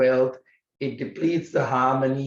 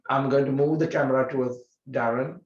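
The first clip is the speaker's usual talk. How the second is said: ordinary delivery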